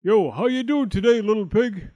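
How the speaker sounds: loud, high, hearty wolf greeting; booming smile in the voice; smiling wolf. A narrator's reading. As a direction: call out big and friendly, like a sly wolf. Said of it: "smiled the wolf".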